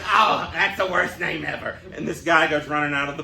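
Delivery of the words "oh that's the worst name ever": lightly voiced cartoon bartender, slow; slow, drawn-out cartoon disgust; a hint of a grumpy cartoon bartender; Moe from The Simpsons. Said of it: "And Moe says".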